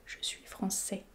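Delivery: ordinary delivery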